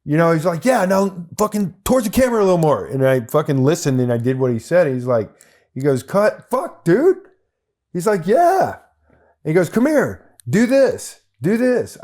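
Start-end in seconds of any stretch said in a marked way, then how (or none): 0.6-2.9 quick, higher, louder coaching; fast excited direction. Retold or imitated. Director calls out a note during the take, fast and excited. 6.0-8.8 very high, loud elated shouts; thrilled director. Retold or imitated. Director shouts his delight at the extra after the cut. 9.7-12.0 higher, louder eager commands; eager summons. Retold or imitated. Director eagerly calls the extra over to do more.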